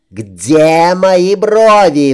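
slow, loud high bellow; roaring. A narrator's reading. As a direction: roar like her father. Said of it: "взревела под стать своему отцу Катрин".

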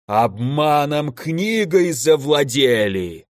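slow, drawn-out monster voice; accusing elemental. A narrator's reading. Elder elemental accusing mages over the book, slow monstrous voice. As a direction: accuse like a lumbering fire giant.

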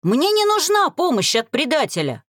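high, heated refusal; angry outcry. A narrator's reading. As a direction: fling it at a traitor. Continues the shouted rejection.

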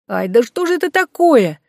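ordinary delivery, nothing marked

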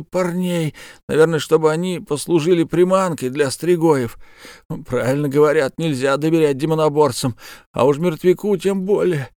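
pained, strained bandit voice; wounded bandit. Acted. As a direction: speak through fresh wounds. Wounded bandit tells his story.

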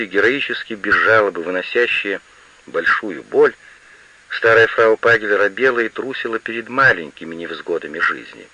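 plain reading voice

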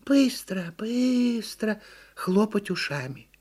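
high, slow turtle lecture; deliberate storybook turtle; instructing turtle. A narrator's reading. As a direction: coach the flyer as an earnest little turtle, stretching words. Turtle explains flying.

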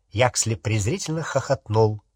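ordinary delivery

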